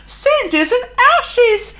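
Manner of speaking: very high, loud, slow exclamation; crying out. Acted. Thomas crying out as he races past. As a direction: cry out in alarm. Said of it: "he cried".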